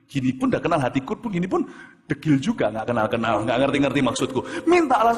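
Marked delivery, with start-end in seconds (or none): 4.7-5.2 insistent command. Retold or imitated. God's command repeated for emphasis.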